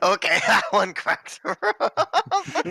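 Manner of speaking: high, loud words through real laughter; cracking up. Real, not acted. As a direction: burst out laughing as you say it. Player cracks up at the joke.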